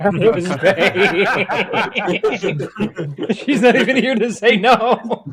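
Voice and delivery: high, loud, through real laughter; laughing hard. Real, not acted. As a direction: crack up while getting the words out. Cracking up at absent payer.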